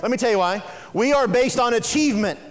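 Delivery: ordinary delivery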